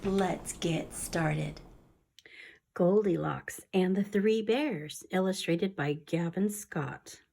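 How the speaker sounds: soft, hushed storytelling whisper; whispered story reading. Acted. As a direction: read the tale in a secret whisper.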